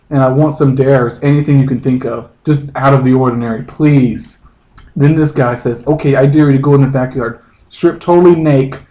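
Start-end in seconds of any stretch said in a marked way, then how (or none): none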